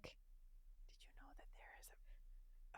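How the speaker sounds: whispered, as if breaking secret news to the mower. Retold or imitated. Imagining telling the lawn mower about the bird, whispered. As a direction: hush it like a secret.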